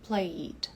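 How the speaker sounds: Played clipped to one beat; Played as one syllable. Shown as a demonstration. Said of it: "caiu fora na pronúncia".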